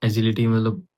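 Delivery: normal talking voice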